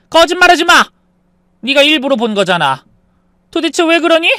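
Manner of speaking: high, loud scolding outburst; angry accusation. Acted. Mother-in-law accusing daughter-in-law of snooping, angry outburst. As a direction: accuse them of lying.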